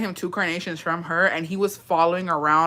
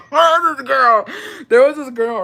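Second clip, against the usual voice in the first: laughing; 6 semitones higher, 7 dB louder than usual. Real, not acted. Laughing while starting story.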